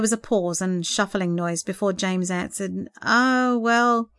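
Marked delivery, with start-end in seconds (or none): 3.0-4.1 drawn-out, mildly sheepish opener. A narrator's reading. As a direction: own up with a touch of sheepishness. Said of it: "he began sheepishly".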